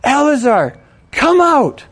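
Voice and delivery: high, loud summons, rising hard on come out; commanding shout. Acted. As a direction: order the dead man out with full voice. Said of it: "he shouted".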